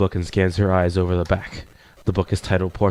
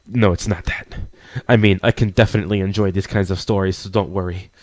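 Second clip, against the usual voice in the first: normal talking voice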